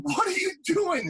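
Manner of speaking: high, slow outraged question; indignant surprise. Retold or imitated. Rescued too early, protesting to the safety guys, indignant.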